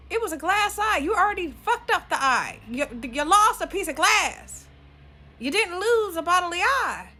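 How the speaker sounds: high, loud outburst; loud incredulous scolding. Acted. Scolding the baby dad over his glass eye, loud and incredulous. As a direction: shout it in exasperated disbelief.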